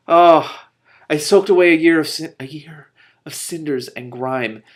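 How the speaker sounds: high, loud, emotional reading; choked up. Real, not acted. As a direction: read through real emotion. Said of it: "I'm gonna get choked up".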